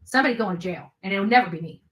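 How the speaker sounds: normal talking voice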